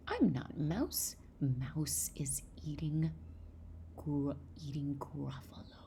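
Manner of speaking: slow, lightly voiced snake; a hint of a slow snake character; storybook snake. Teacher voices the snake, slowly.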